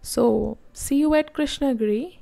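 usual speaking voice

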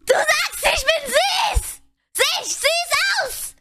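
high, flustered squealing denial; cute anime streamer. Real, not acted. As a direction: squeal it out, embarrassed.

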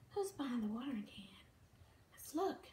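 quick secretive whisper; hushed fast whisper. Acted. Peekaboo suspense for toddler.

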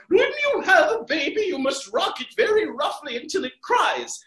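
loud, high shouting Duchess; a booming duchess giving orders. Acted. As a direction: bellow it out like a mad duchess. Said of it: "the Duchess was just like".

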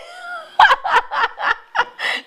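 high, bright burst of real laughter. Real, not acted. Host laughs at caller offering a four-hour show, high laughter. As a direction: laugh out loud at the caller's joke.

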